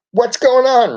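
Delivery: high, loud shout over helicopter blades; shouting over noise. A narrator's reading. Ralphie shouting over the helicopter noise. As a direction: yell to be heard over the rotor. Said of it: "Ralphie shouted over the noise".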